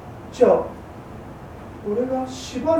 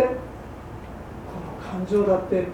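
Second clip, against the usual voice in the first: ordinary talk, nothing marked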